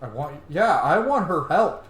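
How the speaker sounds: normal talking voice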